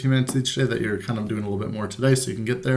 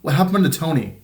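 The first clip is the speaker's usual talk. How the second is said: anxious; 5 semitones higher, 4 dB louder than usual. Acted. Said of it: "she blurted out".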